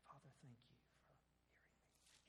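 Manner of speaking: a breathy, voiceless whisper. Shown as a demonstration. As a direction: whisper the prayer almost silently. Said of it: "wasn't just a".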